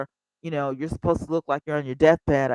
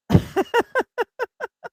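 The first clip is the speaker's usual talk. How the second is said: high laugh. Real, not acted. Laughs before performing rant.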